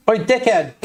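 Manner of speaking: ordinary talk, nothing marked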